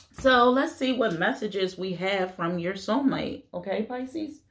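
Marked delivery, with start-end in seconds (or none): none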